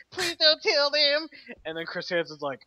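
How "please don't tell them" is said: mock pleading. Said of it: "Are you gonna tell buddy?".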